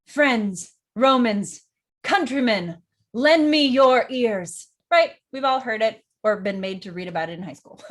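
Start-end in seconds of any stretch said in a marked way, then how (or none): 0.1-4.7 loud orator building to a drawn-out finish; declaiming. Acted. Politician rallying the Roman crowd, loud declaiming. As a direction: address a crowd, pausing after each title. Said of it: "you will definitely recognize, super famous".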